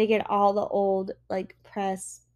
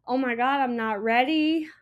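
ordinary delivery

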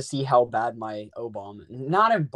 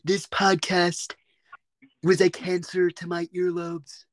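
slow, emphatic presidential impression, louder at the start; Donald Trump; 4 semitones higher, 4 dB louder, slower than usual. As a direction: pronounce it slowly and grandly. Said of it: "like, a Donald Trump voice".

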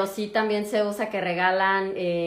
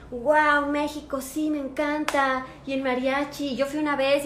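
higher, gushing about Mexico; delighted patient; 5 semitones higher, slower than usual. Retold or imitated. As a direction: mimic an enthusiastic patient. (paciente imitado, entusiasmado y agudo)

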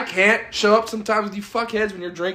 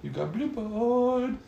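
higher, slow sing-song tease; slow mocking drawl; 6 semitones higher, 6 dB quieter, slower than usual. Shown as a demonstration. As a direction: stretch it out to mock him. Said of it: "repeated in a drawn-out voice".

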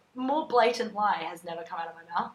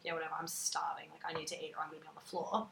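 whispering; 4 semitones lower, 11 dB quieter, faster than usual. Retold or imitated. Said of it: "I was like".

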